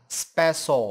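"special" with a plain s instead of sh; wrong S sound. Shown as a demonstration. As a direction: say "special" slowly as spe-so. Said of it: "không đọc giống âm". ("special" đọc chậm, sai âm sh thành s)

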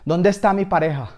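high, loud angry interrogation; threatening demand. Acted. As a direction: demand it like a furious gang boss. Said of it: "me amenazaba y me decía".

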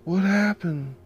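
high, stunned disbelief; shocked. Acted. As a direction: gasp it out in disbelief. Josie hears of a murder.

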